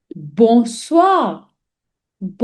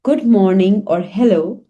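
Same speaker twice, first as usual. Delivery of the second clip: usual speaking voice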